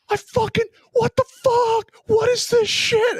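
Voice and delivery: very high, squealed mock outrage; retold outraged outburst. Ranting to Blake about empty ice cream, high outrage. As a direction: squeal it in comic disbelief.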